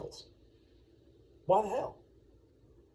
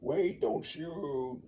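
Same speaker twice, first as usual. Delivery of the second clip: slow pleading; 6 semitones higher, much slower than usual. Retold or imitated. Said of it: "Tryndamere says".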